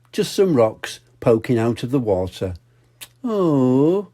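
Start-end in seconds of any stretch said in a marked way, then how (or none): 3.2-4.1 sigh. Acted. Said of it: "she sighed".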